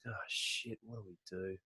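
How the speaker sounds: a soft, stunned curse; hushed dismay. Retold or imitated. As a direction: breathe it out, deflated. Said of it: "I was like".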